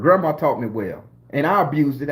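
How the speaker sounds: usual speaking voice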